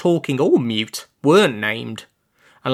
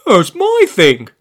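louder, hurt and indignant; blurted, aggrieved; 6 semitones higher, 9 dB louder than usual. Acted. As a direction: blurt it out as if wronged. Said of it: "Olaf blurted out, aggrieved".

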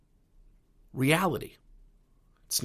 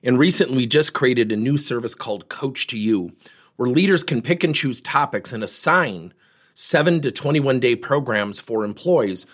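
louder, projected podcast sign-off; promotional read; 6 dB louder than usual. Acted. Podcast host thanks listeners and pitches coaching service, promotional read. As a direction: deliver it as a scripted company promo.

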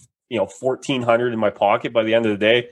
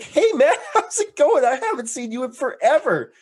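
much higher, laughing gush; overeager greeting; 12 semitones higher than usual. Retold or imitated. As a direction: greet an old friend far too keenly. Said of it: "He's like".

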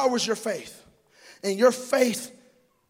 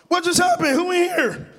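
mock startled; 6 semitones higher than usual. Startled by the flush.

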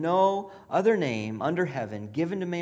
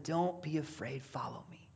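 soft, hushed and tender; gentle call; 3 semitones lower, 9 dB quieter than usual. Acted. Jesus calling Peter to follow, softly and gently. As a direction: invite him quietly, no push.

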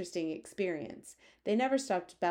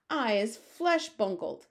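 slow, loud, raised roar; giant's roar; 4 semitones higher, 5 dB louder, slower than usual. Acted. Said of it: "roared the flesh lump eater".

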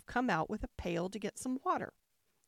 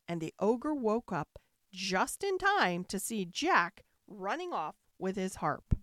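ordinary delivery, nothing marked

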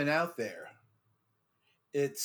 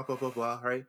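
usual speaking voice

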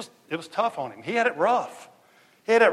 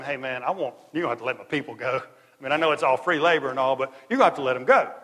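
fast, lower casual plea; Moses to Pharaoh, quick; 4 semitones lower, faster than usual. Acted. Moses telling Pharaoh fast and low to free the slaves. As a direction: rattle it off low and quick, man to man. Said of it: "you're gonna tell him".

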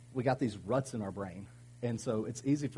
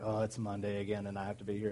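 mock Monday dread; 2 semitones lower than usual. Worker facing Monday morning again, mock weary grumble. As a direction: drone it out wearily, fading away.

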